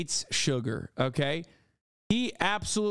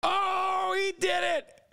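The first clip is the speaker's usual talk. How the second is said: excited shout; 13 semitones higher, much slower than usual. Real, not acted. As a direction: yell it out in delight. Streamer reacts to clip moment.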